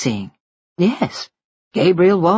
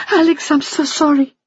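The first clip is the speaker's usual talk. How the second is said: higher, tearful plea for forgiveness; crying apology; 11 semitones higher than usual. Acted. Wife tearfully apologising to her husband. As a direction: sob out the apology. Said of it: "the woman burst into tears".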